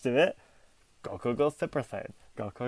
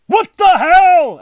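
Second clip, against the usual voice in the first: very high, drawn-out loud yell; disbelieving fan's full-volume scream; outraged yell; 16 dB louder, much slower than usual. Acted. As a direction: bellow it in exaggerated outrage, long and high. Voices outraged Dragon Ball viewers.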